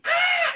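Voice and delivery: very high, very loud mock scream; imitated scream. Retelling the Predator's painful self-surgery, high imitated scream. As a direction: shriek in pretend agony. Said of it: "he just fucking screams".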